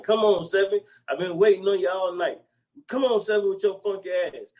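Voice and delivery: high, pleading chant at the dice; mock dice gambler. Retelling the dice scene, high mock pleading. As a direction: beg the dice like a desperate gambler.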